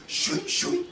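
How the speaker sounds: whispered shoo shoo of a call dropping; whooshing hang-up noise. Retold or imitated. Husband imitating a Skype call hanging up, airy whoosh. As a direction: mimic a call cutting off. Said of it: "That's how it, it hangs up".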